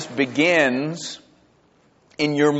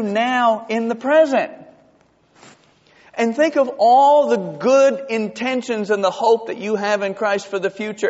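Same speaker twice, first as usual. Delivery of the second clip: radio preacher; 6 semitones higher than usual. Retold or imitated. Preacher quoting the radio man's hopeful counsel. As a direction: hand out the promise like a radio preacher. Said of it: "He said".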